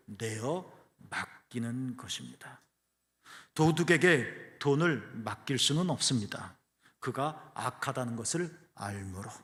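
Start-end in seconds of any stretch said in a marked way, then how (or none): none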